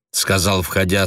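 ordinary delivery, nothing marked

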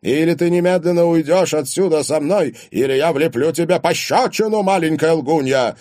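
loud, high, furious ultimatum; barked threat. A narrator's reading. Father threatens lying daughter with a slap, barking. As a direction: threaten her in a rage. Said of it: "рявкнул Платон Евсеевич".

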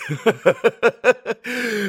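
high, long acted cackle; frenzied laugh. A narrator's reading. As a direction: burst into a feverish, high laugh. Mitya's frenzied speech breaks into laughter.

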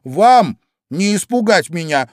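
Alice standing up to the cards; defiant exclamation. A narrator's reading. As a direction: shout it bravely. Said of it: "воскликнула Алиса".

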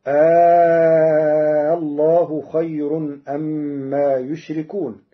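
slow chanted tajweed with يشركون on ya, yushrikun; Quranic recitation. Shown as a demonstration. Teacher recites the Hafs form of يشركون. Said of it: "قرأ ورش كلمة يشركون".

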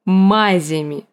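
drawn-out, scornful repeat; scornful echo. A narrator's reading. Burned man recalls to the girl how he was treated, bitterly scornful. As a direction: echo it slowly with bitter contempt.

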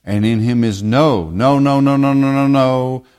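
each no hammered in a row; repeated no. Acted. Preacher pressing the point to his congregation, insistent. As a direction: drive the no home again and again.

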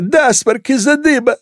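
very high, congested cadet; squeezed, high voice with a head cold; stuffed-nose voice. A narrator's reading. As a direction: talk through a badly blocked nose. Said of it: "Насморк у неё?".

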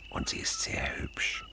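hushed confiding whisper; secret whisper. Acted. Uncle confiding to the boy about his mother, whispered. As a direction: whisper it like a shared secret, slowly.

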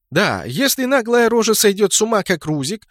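high, boastful character line; proudly crowing. A narrator's reading. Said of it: "с гордостью сказала Сцилла".

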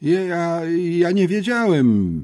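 put-on high, halting boyish excuse; flustered stammer. A narrator's reading. Boy excusing himself to the headmistress, stammering. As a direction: stumble over the first word, pitched high. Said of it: "wymamrotał Dzidek".

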